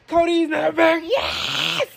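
very high squealing shout; high shriek stretched into a long cheer; overjoyed scream. Real, not acted. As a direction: scream it high like a thrilled fan. Celebrating Cody's return.

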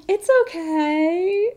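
high, slow, drawn-out reassurance; imitated pizza staff. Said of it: "They're like".

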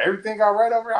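high-pitched check-in call; imitated RA. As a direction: mimic a passing supervisor asking after them.

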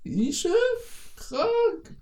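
very high, laughing squeal of delight; gushing high squeal. Retold or imitated. Mocking gushy friends, squealing high. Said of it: "bei irgendwelchen Deutschen sagen so".

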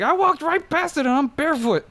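high-pitched imitation of a freaked-out friend; alarmed. Retelling John having passed the scorpion barefoot, alarmed. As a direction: squeal it in mock alarm.

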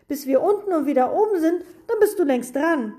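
high, hurried, loud protest; wailing complaint. A narrator's reading. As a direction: keep whining, rushing through it. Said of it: "jammerte Max". (hastig weitergejammert)

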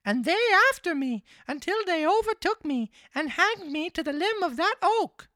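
very high, loud puppet voice; marionette voice. A narrator's reading. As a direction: pitch it way up as the naive little marionette. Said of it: "continued the marionette".

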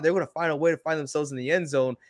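normal talking voice

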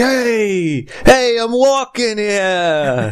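high-pitched drawn-out mock street yell; mock New Yorker yell. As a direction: playfully yell at a passer-by, high and stretched. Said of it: "yell at you".

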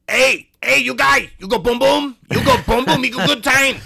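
put-on high, loud, raspy accented hawking; mock a rough-voiced hustler calling out for business; raspy street solicitor. Street solicitor calling to a passing tourist, raspy and loud. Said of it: "the bass and rasp out of your voice".

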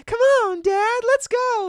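mock a bratty teenage daughter; whiny teen girl. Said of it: "she seems like she's a totally".

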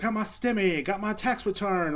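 high mocking singsong of bragging posters; mocking others. Mimicking gloating Facebook friends, high and mocking. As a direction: lilt it like gloating Facebook posts. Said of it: "everybody's like".

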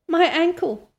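high-pitched gasp of pain; acted gasp. A narrator's reading. Injured Anne to Missus Barry, acted gasp. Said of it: "gasped Anne".

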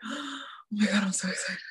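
a breathless, voiceless whisper of glee; hushed mock squeal, all breath; excited whisper. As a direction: whisper it like you can't contain the secret excitement. Retold own reaction, whispered.

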